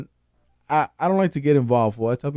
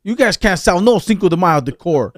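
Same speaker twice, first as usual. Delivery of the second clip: mock offended complaint; 4 semitones higher, 6 dB louder than usual.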